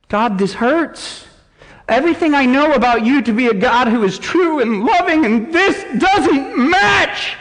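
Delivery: ache through each word to God; pained cry of a believer; anguished lament. Acted. Voiced lament prayer to God.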